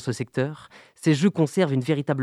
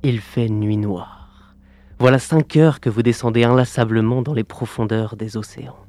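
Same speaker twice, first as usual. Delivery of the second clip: epic fantasy narrator; 2 semitones lower than usual. Acted. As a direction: draw the listener down into the dark. Immersive deep-sea scene narration.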